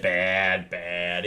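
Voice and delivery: usual speaking voice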